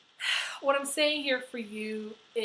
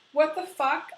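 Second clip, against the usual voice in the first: louder, higher exasperated what the fuck; mock outburst; 5 semitones higher, 5 dB louder than usual. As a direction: blurt it out, half-joking frustration. Imagined outburst over divorce.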